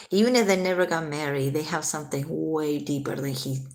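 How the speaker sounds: ordinary talk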